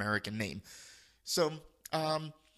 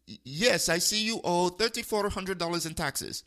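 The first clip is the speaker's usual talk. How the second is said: lightly mimicked Indian accent, a bit higher; imitated Indian accent; 4 semitones higher, 3 dB louder than usual. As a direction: play the scam caller's Indian accent. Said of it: "duplicate his voice and accent".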